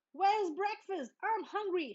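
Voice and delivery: high, whiny demand like a hungry cub; papa hams up a hungry cub; mock shout. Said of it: "shouted Papa".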